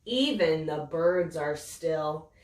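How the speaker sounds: ordinary delivery, nothing marked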